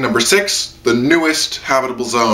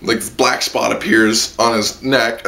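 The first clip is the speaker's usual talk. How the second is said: usual speaking voice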